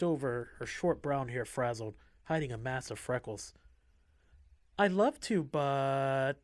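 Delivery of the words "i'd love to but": cutesy. A narrator's reading. Cutesy inmate hesitates.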